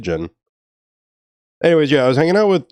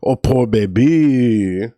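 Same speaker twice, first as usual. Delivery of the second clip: put-on Creole accent; much slower than usual. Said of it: "a really Creole way of calling somebody".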